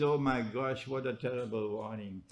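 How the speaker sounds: usual speaking voice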